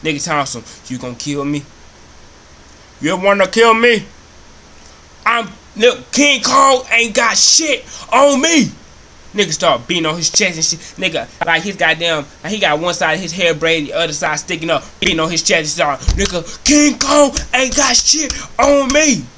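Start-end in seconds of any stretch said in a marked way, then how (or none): 0.9-8.7 hammed-up roaring movie-star bravado, building louder and higher; Denzel Washington. Cockroach challenging the man about to spray it, defiant mock bellow. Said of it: "Had a Denzel Washington voice". 16.2-19.2 hammed-up, high, slow movie-star shout; Denzel Washington. Roach beating on its chest at the man, bellowed mock bravado. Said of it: "Had a Denzel Washington voice".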